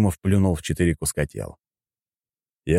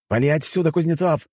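high-pitched yell to get away; 10 semitones higher than usual. A narrator's reading. Fighter telling his comrade to leave, yelling. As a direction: yell the order across the arena. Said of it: "закричал Женя".

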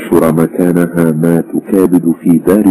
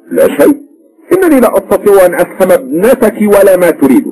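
higher, exasperated explaining; a lightly voiced flustered husband; husband defending himself; 13 semitones higher than usual. A narrator's reading. Husband explains to mother-in-law, exasperated.

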